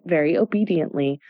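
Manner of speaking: plain reading voice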